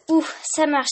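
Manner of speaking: relieved exclamation; relieved cry. A narrator's reading. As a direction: let the relief burst out. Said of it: "s'écrie-t-elle".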